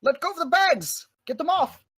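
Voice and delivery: high, urgent captain's command; Grafford. Acted. Captain telling crew to drop bags, high urgent call. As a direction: order the crew in a high calling voice.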